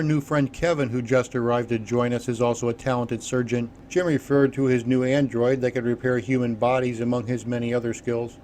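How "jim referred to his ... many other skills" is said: ordinary delivery, nothing marked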